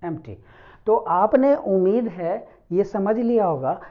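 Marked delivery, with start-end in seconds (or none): 0.0-0.4 एम्प्टी softly, opening on an e sound; e vowel. Shown as a demonstration. Teacher correcting the mistaken empty, soft e-vowel model. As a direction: quietly restate एम्प्टी with e, not i. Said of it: "ना कि इम्प्टी".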